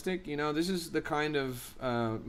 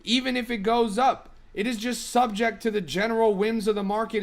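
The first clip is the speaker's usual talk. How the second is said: exasperated and raised, higher pitch; venting at chat in disbelief; worked up; 5 semitones higher than usual. Real, not acted. Streamer ranting at his chat, worked up and higher. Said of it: "I'm getting worked up right now".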